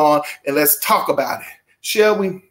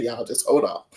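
usual speaking voice

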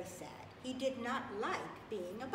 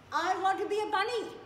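loud, high joyful announcement; excited; 10 semitones higher, 12 dB louder than usual. Acted. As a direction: shout it out with delight. Happy ending declaration.

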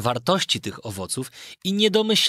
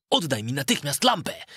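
plain reading voice

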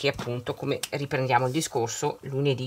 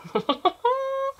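sudden squeaky burst of laughter; high laugh. Real, not acted. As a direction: laugh out high, amused at the repeat. Laughs at repeated notebook find. (risata acuta e improvvisa)

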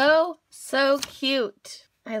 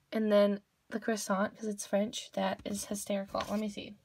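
ordinary talk, nothing marked

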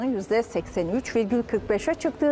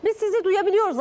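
raised voice, higher, to reach a bad line; calling out; 8 semitones higher, 4 dB louder, faster than usual. Real, not acted. Anchor calling to a silent phone guest, raised voice. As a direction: call out to be heard.

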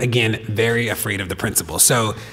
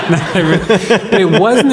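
laughing; 6 semitones higher, 6 dB louder than usual. Real, not acted. Echoing co-host, laughing.

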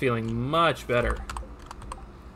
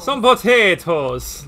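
slow, loud, higher Irish lilt on potatoes; Irish accent; 7 semitones higher, 10 dB louder, slower than usual. Pretend. Streamer answering friend's tease, hammed-up slow Irish accent. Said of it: "in a bit of an Irish accent".

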